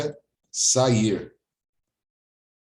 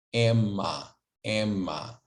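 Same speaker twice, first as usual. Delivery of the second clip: ordinary talk, nothing marked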